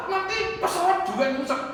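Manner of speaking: high, worried congregant's excuse; mock alarm. Congregant's acted fear of flying. (cemas dibuat-buat, nada tinggi)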